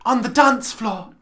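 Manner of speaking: high, loud theatrical alarm; sing-song panic like a cartoon fairy; dramatic musical fairy. Acting a fairy's musical line.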